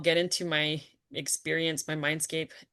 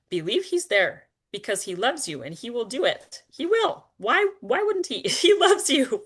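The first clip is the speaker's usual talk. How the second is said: rising, louder, fervent reassurance; excited insistence; 7 semitones higher, 7 dB louder than usual. Real, not acted. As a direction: build up to an eager, urgent cry. Excited insistence that Christ loves you.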